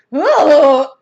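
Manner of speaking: very loud cry of disgust; scream. A narrator's reading. Missus Ralph bites the cake, screaming. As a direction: yelp after a horrible bite. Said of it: "she screamed".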